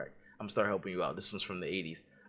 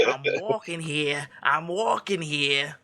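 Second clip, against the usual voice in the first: slow, higher New York street yell; mock New York yell; 6 semitones higher, 4 dB louder, much slower than usual. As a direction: drag it out like an angry New Yorker. Quoting a film line in character.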